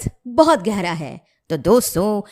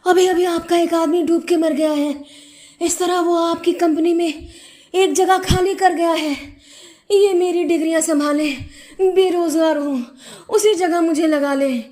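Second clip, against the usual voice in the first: lightly acted out-of-breath plea, pitched up; acted panting; 8 semitones higher than usual. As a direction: report breathlessly after running to the company. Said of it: "हांफते हांफते बोला". (हल्का हांफते हुए, नाटकीय विनती)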